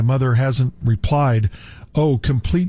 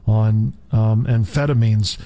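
usual speaking voice

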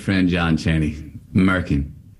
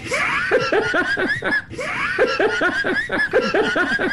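big laugh. Real, not acted. [name] bursts out laughing at his own punchline.

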